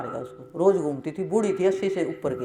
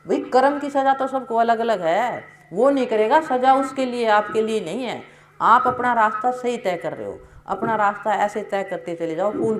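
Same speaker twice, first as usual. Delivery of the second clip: higher, louder preaching push; rising sermon fervor; 4 semitones higher, 3 dB louder than usual. Real, not acted. Preacher to the congregation on karma, fervent and louder. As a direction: drive the point home to the congregation.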